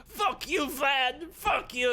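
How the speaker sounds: very high, loud scream; shrieking, helpless rage; furious screaming. Acted. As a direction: scream curses after someone leaving. Eddie screams after his captor.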